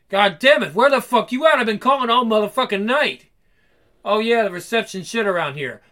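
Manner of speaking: loud, acted indignation; angry phone rant. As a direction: lay into someone who went missing.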